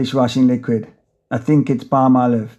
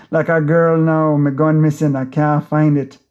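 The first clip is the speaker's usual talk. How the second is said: ordinary delivery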